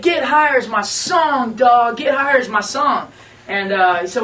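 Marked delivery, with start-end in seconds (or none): 0.0-3.1 higher, louder, slow and emphatic; excited shout. Retold or imitated. Randy on speakerphone claiming the song, loud slow excited shout. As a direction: hammer the song title home, slowly.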